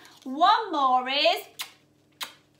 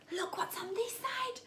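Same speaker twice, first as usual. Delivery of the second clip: hushed secretive whisper; 5 semitones higher, 12 dB quieter than usual. Acted. Teacher drawing children to the next page in a whisper.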